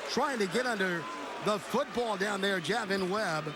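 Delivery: fast, high excited play-by-play; excited call. Real, not acted. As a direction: gasp at the near touchdown then rush on. Near touchdown called live.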